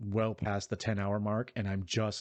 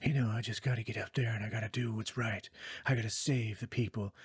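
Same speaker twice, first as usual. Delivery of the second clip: smoky, stoic hero voice, mockingly put on; hushed, self-serious heroine impression; Aloy. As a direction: imitate a husky near-whisper. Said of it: "trying to be this sort of smoky".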